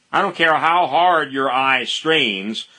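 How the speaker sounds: usual speaking voice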